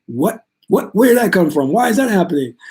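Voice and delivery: mock-blindsided, voice climbing on each question; caught off guard. Teacher acting out being blindsided by life, rising surprise. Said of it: "I will be like".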